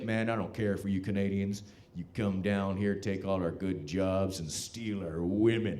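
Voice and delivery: lightly voiced, low-pitched American complainer; retold American guy. American guy to visiting Canadians, resentful low voice.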